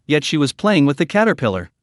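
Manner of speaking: ordinary delivery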